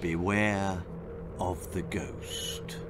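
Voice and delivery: low, slow eerie ghost-story voice; hushed, drawn-out spooky warning; spooky sign reading. Acted. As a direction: drop low and soft for the warning. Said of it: "The sign on the door reads quite simply".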